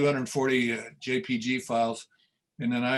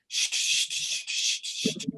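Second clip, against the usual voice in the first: unvoiced shh calling a cat; shushing call; slower than usual. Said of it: "they go".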